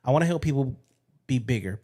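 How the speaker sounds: ordinary delivery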